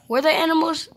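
high, loud mock gasp of worry; suddenly fake-worried; hammed-up shock; mock alarm.

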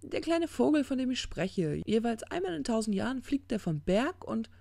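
high-pitched, pointed retelling; exasperated parable teller; impatient demon. Acted. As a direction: insist on it, pitched well up. Crowley resumes, impatient.

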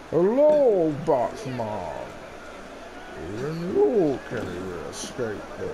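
put-on movie villain voice with sweeping pitch; Bane. Gamer playing villain to the viewers, swooping villain voice. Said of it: "so the Bane impression".

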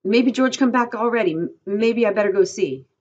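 high, loud, anxious worry; agitated and fearful; frightened voice. A narrator's reading. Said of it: "in a frightened voice".

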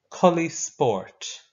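very slow, deliberate Irish phrase; very slow model phrase. Shown as a demonstration. Teacher modelling the Irish for tracksuit, very slowly. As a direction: stretch it out so the class can copy. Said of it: "We're gonna say them all again".

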